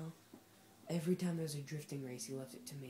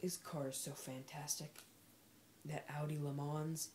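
normal talking voice

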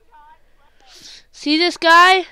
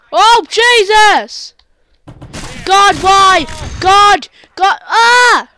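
slow loud drawn-out yells; dragged-out panicked yell; 6 semitones higher, 9 dB louder, slower than usual. Real, not acted. Gamer yelling on the spike fall. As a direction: yell through a long fall.

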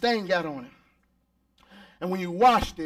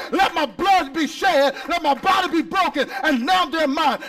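higher and louder, punching each phrase; preaching at full force; 5 semitones higher, 4 dB louder than usual. Real, not acted. Pastor preaching full force about redemption to the church. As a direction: preach it hard to the congregation.

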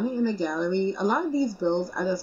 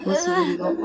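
ordinary talk, nothing marked

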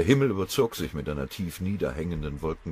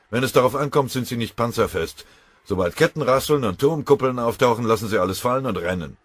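loud, higher and urgent general; commanding general; 8 semitones higher, 11 dB louder than usual. Acted. General tells his chief of staff the flank is in danger, loudly. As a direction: hammer out the worries about the flank.

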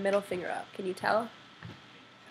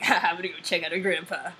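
mock stalker voice. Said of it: "No one sounds like that".

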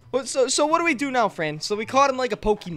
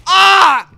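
sudden loud, higher scream; a real jolt of fright; startled scream; 8 semitones higher, 10 dB louder than usual. Real, not acted. Player screams as the demon breaks free.

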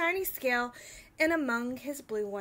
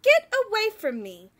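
angry; 6 semitones higher, 6 dB louder than usual. Acted. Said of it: "cried the rainbow fish".

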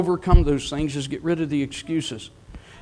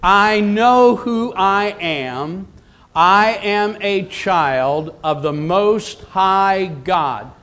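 louder, very slowly, every word landed; slow declaration; 4 dB louder, much slower than usual. Shown as a demonstration. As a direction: chant it together with the congregation. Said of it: "Can you say that with me?".

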